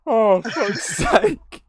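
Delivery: high, drawn-out exasperation through laughter; real helpless giggles on a slow groan; laughing. Real, not acted. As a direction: barely get it out for laughing. Still cracking up at the scene.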